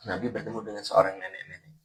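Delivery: usual speaking voice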